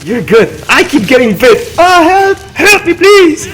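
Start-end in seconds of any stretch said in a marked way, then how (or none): 1.8-3.5 high, loud playful plea; hammed-up cry for help; mock begging. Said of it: "but he's begging".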